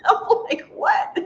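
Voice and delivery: a high, laughing "What?"; stunned laugh. Retold or imitated. Traveller told she isn't on the flight, laughing in shock. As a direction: squeak it out in disbelief.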